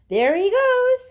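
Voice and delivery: high, loud, slow shout. Acted. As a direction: shout and point. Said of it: "somebody shouted".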